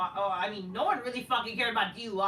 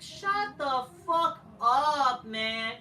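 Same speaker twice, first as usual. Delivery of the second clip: slow, louder, disgusted; fed-up snap; 5 semitones higher, 6 dB louder, much slower than usual. Real, not acted. Ranting at WWE's Rocky ripoff, slow fed-up snap. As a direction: tell him to shut up.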